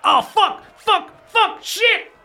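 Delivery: high-pitched panicked cursing; panicked man. Acted. Man upstairs as the door is kicked in, high panicked cries. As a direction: yelp the curses in a high voice. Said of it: "you hear a man's voice go".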